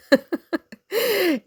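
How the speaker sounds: high bitter little laugh; sarcastic laugh. Real, not acted. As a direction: laugh at the irony. ضحكة ساخرة بعد شكر الحاسدة. (ضحكة ساخرة)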